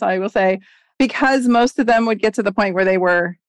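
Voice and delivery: usual speaking voice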